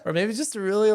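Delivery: ordinary delivery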